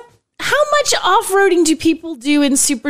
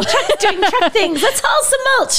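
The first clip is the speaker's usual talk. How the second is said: high, slow, gushing truck fan; hammed-up excitement; 8 semitones higher, slower than usual. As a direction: ham up the excitement. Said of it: "I got carried away".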